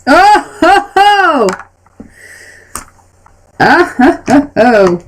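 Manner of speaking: hearty ho ho ho laugh. Real, not acted. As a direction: laugh big and booming at the dice. GM reacts to a roll.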